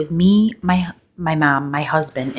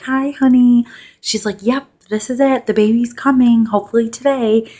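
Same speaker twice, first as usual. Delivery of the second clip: excited mom; 9 semitones higher, 6 dB louder than usual. Retold or imitated.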